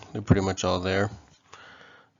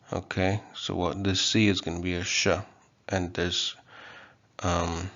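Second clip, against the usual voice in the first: usual speaking voice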